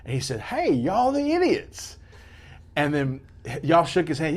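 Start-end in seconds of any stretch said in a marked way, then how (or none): 0.4-2.0 high, slow, drawn-out mock greeting; mock stranger greeting. Fan greeting the hosts in a high, slow mock voice. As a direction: stretch it out like an excited fan.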